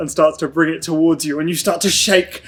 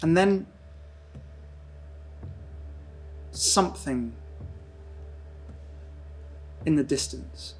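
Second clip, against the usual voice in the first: dreamy scene narration; 9 dB quieter than usual. Acted. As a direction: let the words float like drifting in nothing. GM narrates Mason's drifting dream.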